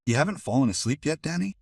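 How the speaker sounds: put-on high little girl voice; child voice. A narrator's reading. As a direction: voice a young girl asking. Daughter asks, child voice.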